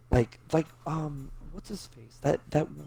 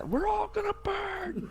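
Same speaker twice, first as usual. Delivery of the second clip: panicked screaming. Retold or imitated. Mock doom, laughing.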